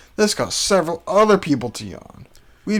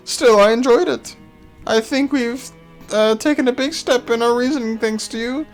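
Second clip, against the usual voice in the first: high, bouncy and cheeky; perky high voice; 7 semitones higher, 3 dB louder than usual. Acted. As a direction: lift the voice high and playful. Erica voiced, bright, higher.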